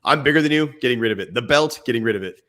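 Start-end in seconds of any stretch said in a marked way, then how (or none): none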